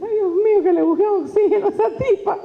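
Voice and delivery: high-pitched mock alarm; worried listener. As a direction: fret as if the speaker might collapse. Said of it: "Tú dices".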